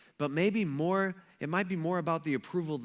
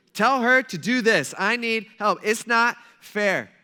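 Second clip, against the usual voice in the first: higher, louder bossy whine; bossy demand; 5 semitones higher, 8 dB louder than usual. Acted. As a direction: boss Jesus around like a fed-up host. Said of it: "she's so worked up. She's bossing Jesus around".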